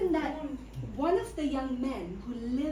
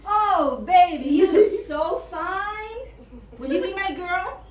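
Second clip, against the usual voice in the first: put-on American accent; 3 semitones higher, 7 dB louder than usual. Young man in Kolkata woos Cucurachita in American accent. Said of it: "an American accent in Kolkata".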